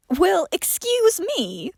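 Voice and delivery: slow, indignant huff; huffy. Acted. John huffing at Johnny about nudity, slow and offended. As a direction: drag it out, offended. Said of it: "John huffed".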